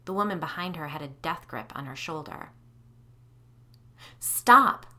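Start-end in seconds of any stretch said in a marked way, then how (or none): 4.2-4.8 sharp loud command to stop; demanding shout. Acted. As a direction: bark it out loud. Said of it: "I demanded".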